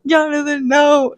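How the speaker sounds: high, loud mock wail of no, fading at the end; anguished cry. Reader crying out at Jonathan's death, a high loud mock wail. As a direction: cry out as if losing a father. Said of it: "and you're like".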